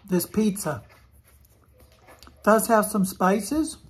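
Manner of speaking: talking between bites; chewing while talking. Real, not acted. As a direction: mouth partly full of food. Seen 1: Bites pizza before; cheeks bulge and chews through early words.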